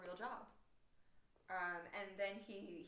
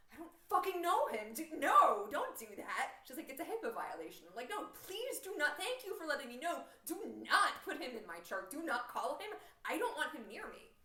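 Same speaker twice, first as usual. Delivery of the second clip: high, loud, exasperated retold protest; emphatic refusal; 6 semitones higher, 9 dB louder than usual. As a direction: act out an alarmed, insistent no. Acted refusal to the nurse.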